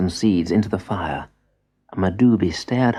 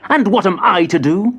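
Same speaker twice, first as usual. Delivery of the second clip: higher, louder indignant demand; furious; 10 semitones higher, 4 dB louder than usual. Acted. Angry boy demands his role from grandfather, higher and louder. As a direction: fling the question out in outrage. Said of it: "Madubi was furious".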